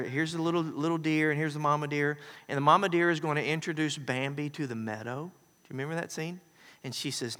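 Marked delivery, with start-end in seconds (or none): none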